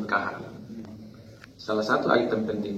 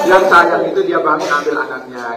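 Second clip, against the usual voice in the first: much louder, driving the point home; emphatic loud point; 7 semitones higher, 9 dB louder than usual. Real, not acted. Speaker telling husbands what loving husbands do, loud. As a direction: hammer it home to the room.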